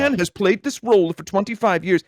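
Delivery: mock indignation. Imitating fans upset by the recasting, mock indignant plea. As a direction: plead it in exaggerated dead earnest. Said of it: "dead serious, and it was really sad".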